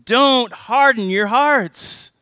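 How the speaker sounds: loud, high, pleading sermon call; preaching at full force. Acted. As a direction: preach it out, urging them to turn back. Urgent appeal to respond.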